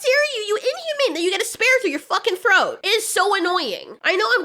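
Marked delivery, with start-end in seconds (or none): 0.0-1.1 high mock-indignant squeal; mock outrage. Mocking tourists scolding locals, in a high indignant voice. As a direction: play a preachy tourist shocked by local food. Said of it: "you're like".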